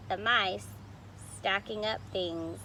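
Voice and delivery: usual speaking voice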